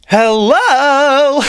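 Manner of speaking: high, loud "hello"; calling out. Acted. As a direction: call out to get their attention. Character calls out to arguing team.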